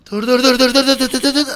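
high, loud rapid dur; panicked yelling. Real, not acted. Host yelling stop stop while his character slides. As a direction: stammer it out in panic.

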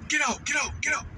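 high repeated get out; mock police order. Police ordering them out of the car, mock yell. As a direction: bark it like a cop.

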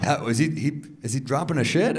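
ordinary delivery

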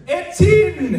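high, loud, laughing exclamation; mock teacher exclaiming. Teacher reacting to the student's age, loud gleeful mock shout. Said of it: "he's like".

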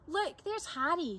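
high, excited call; excited shout. A narrator's reading. Boy spotting his dog across the lawn, high excited shout. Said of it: "shouted Tom".

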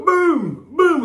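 high, punchy Boom shout; raving Boom call. Retold or imitated. Raving in the nineties, he shouts his catchphrase high. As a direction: call it out like on the rave floor. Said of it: "you just go".

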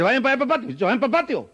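put-on nervous, high, hurried shooing of the kids. Nervous mother shooing her kids to the patio, high and hurried. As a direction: shoo the children out in a panic. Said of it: "Y ella de lo más nerviosa dice".

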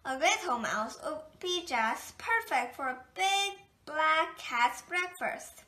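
usual speaking voice

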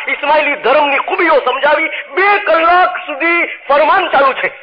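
high, rising preacher's build-up; fervent sermon climax, voice pitched high; preaching at full force. Acted. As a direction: lift the congregation, climbing in pitch. Preacher building up about two-hour farman.

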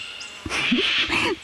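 short breathy high giggle. Acted. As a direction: giggle to herself at the thought of a witch brew. Nelly giggles about the witch. (kurzes hohes Kichern)

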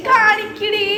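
loud, high call across the room. Real, not acted. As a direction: call out to her. Calling out to someone.

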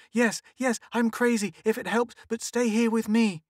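high, loud desperate begging; hysterical pleading. A narrator's reading. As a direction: beg him to stay. Hysterical wife begs husband.

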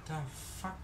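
normal talking voice

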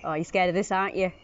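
ordinary talk

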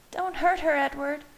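high-pitched, alarmed plea; crying out. A narrator's reading. Bella begs Edward to spare Leah, crying out. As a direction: cry out to stop them. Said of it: "cried Bella".

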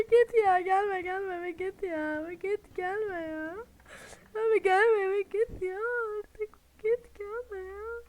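high pleading, easing down toward normal; fading frightened pleas. Real, not acted. To the monster, frightened begging that slowly settles. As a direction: plead with it, calming a little.

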